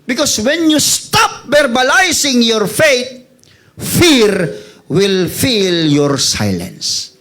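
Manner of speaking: slow it down and let the last words drop; dramatic slow punchline. Acted. (mabagal at madramang pagbigkas)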